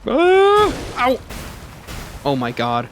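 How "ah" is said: high sharp yelp; startled yelp. Real, not acted. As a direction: cry out when struck. Hit in game fight.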